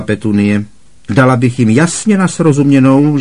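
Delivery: loud, high scornful rant; scornful Aunt Marge. A narrator's reading. Aunt Marge scorning Harry over tea, loud and contemptuous. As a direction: lecture him with contempt.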